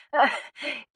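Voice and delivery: short, breathy hollow laugh; dry little huff of a laugh. A narrator's reading. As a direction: laugh briefly without amusement. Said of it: "She let out a hollow laugh".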